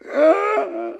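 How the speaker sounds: ordinary talk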